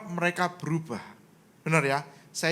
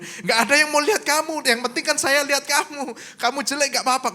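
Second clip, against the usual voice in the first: raised, louder mock exasperation; exasperated husband; 7 semitones higher, 4 dB louder than usual. Husband hurrying his wife as she does her hair, exasperated. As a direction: act out impatient pleading at a higher pitch.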